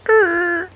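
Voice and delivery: very high, loud screeching "errr"; imitated brake screech. Telling how the cops pulled up, imitated tire screech. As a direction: mimic car brakes with one sustained high squeal. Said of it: "screech on their brakes".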